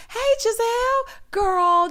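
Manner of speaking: high, slow sugary mock; sarcastic sing-song greeting; mock sweet voice. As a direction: coo it in a fake-friendly voice. Voicing Candace sarcastically.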